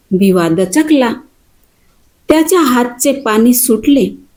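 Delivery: ordinary delivery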